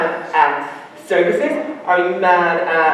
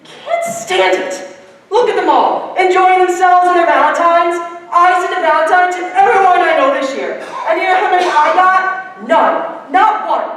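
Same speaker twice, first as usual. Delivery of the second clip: higher, agitated complaint; frustrated outburst; 7 semitones higher than usual. Real, not acted. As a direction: vent the frustration. Boy upset, no Valentines.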